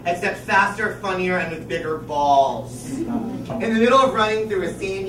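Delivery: normal talking voice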